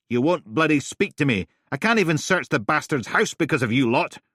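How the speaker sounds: loud, high, exasperated; angry yell. Acted. Steele yelling at Helen, loud and exasperated. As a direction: hurl the accusation at her.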